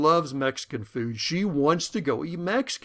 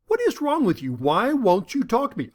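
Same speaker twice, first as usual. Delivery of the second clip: frustrated wife; 7 semitones higher, 4 dB louder than usual. Retold or imitated. Wife frustrated, much higher.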